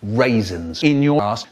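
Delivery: ordinary talk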